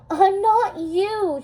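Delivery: high, slow, choked-up defiance; tearful. Acted. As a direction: hold back tears as you say it. Mal confronts her mother in tears.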